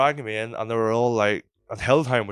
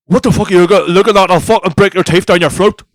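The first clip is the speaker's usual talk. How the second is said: angry bouncer roar, much louder and higher; shouted threat; 11 semitones higher, 18 dB louder than usual. Acted. Bouncer threatening a teenager for looking at him, furious shout. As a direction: bellow it like a bouncer spoiling for a fight.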